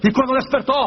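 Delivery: usual speaking voice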